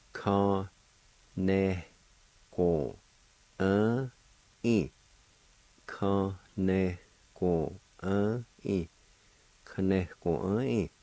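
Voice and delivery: Ka, ne, ko, uh, e said one syllable at a time with small gaps. Shown as a demonstration. Teacher shows the class each syllable of the word Ka, ne, ko, uh, e. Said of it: "It's pronounced like this".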